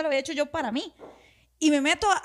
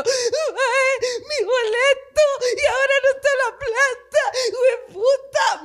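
high, louder, slow wailing half-laughed; mock sobbing; 12 semitones higher, 5 dB louder, slower than usual. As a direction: wail it like a hammed-up cry, laughing through. Retelling her own crying fit.

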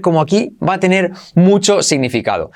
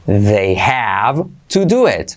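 slow careful model; 6 semitones lower, much slower than usual. Shown as a demonstration. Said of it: "Esa T casi no se pronuncia".

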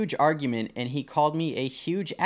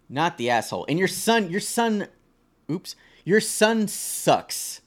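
ordinary talk

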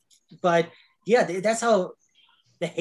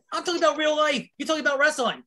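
exasperated, pitch up; 9 semitones higher, faster than usual. Real, not acted. Exasperated rebuttal to Adam.

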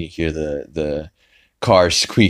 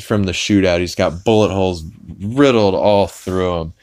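normal talking voice

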